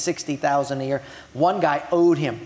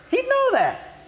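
mock indignation. Mocking Simon's reasoning.

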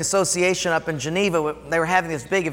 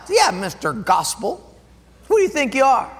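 higher sneering taunt; mocking cantankerous guy; 5 semitones higher than usual. School administrator sneering at the youth pastor, mocking. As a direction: jeer at him, scornful. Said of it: "he'd call me Mister Gospel".

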